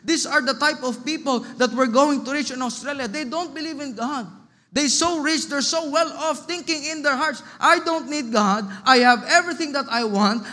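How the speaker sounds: high, driving pulpit delivery; forceful preaching. Acted. Preacher warns of rich people who don't believe, preaching forcefully. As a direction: proclaim it with rising conviction.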